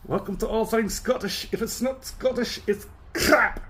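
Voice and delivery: high, far-off mocking voice of a Scotch snob. Reviewer mocking a Scotch purist channel, high mocking snob voice. Mock snobby Scottish whisky channel.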